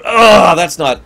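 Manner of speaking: loud, high outraged "oh", then dropping; mock indignation. Mimicking critics of fake accents, mock indignation. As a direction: imitate an affronted objector.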